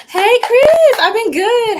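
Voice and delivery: high, loud excited hello to a viewer; light up and call out a happy hello; excited greeting. Real, not acted. Streamer greeting a viewer by name, excited.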